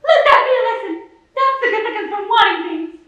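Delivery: loud, high mock lecture; scolding mother. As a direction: imitate a mother shrilly driving home a lesson. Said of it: "She said".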